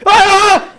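high, loud startled shout; teacher's alarmed yell. Retold or imitated. Teacher finding kids choking a boy, alarmed yell. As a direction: yell in shock as you rush in.

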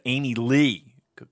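ordinary talk, nothing marked

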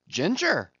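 high, drawn-out suspicious question. A narrator's reading. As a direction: sniff out something fishy. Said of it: "suspiciously asked Stubb".

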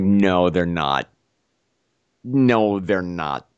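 low, drawn-out mock refusal; lightly acted, slow and deep denial. Handcuffed man balks at pulling the string, slow refusal.

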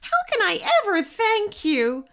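high, grateful exclamation; overjoyed cry. A narrator's reading. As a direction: gush with relief and thanks. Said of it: "she cried".

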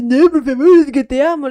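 hammed-up begging; mock pleading. Imitating the crying ex begging to be taken back, loudly pleading. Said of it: "te vuelve con el llanto".

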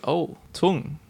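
ordinary talk

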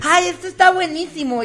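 put-on high, delighted valley girl; high girly voice. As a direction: squeal it like a fresa girl. Niña imitada, bebida rica. (voz chillona de niña fresa)

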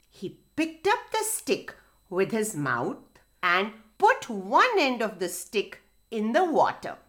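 usual speaking voice

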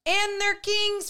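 high, slow mock echo; silly high echo. Co-host echoes in a silly voice.

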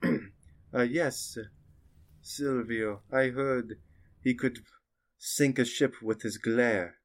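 soft, slow, halting witch voice. Acted. The witch says.

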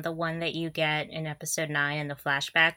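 ordinary delivery

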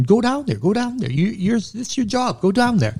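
high, pushy retelling of the interpreter urging him on; urgent insisting. Retold or imitated. Interpreter pushing the medic down the hill, urgent and insistent.

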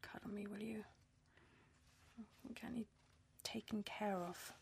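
soft unvoiced whisper. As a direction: breathe it to him, close and needy. She asks to be held.

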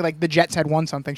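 usual speaking voice

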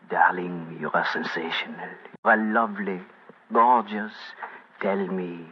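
put-on low, hushed French-accented seducer. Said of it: "whispering in my fine French accent".

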